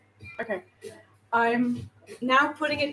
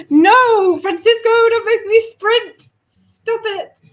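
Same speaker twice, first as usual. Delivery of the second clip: shout; 9 semitones higher, 12 dB louder than usual. Real, not acted. Shouting at someone in kitchen.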